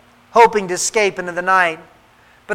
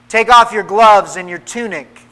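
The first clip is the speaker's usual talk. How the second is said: usual speaking voice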